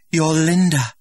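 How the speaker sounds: high, loud insistence; hopeful prompting. A narrator's reading. Linda feeding the director his line, insistent. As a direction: put the words in his mouth. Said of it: "Linda prompting him".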